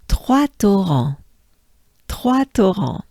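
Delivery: Trois as TWAH, Torrents as to-RAHN; French Trois Torrents. Shown as a demonstration. Teacher introduces Trois Torrents to learners. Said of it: "let's do this".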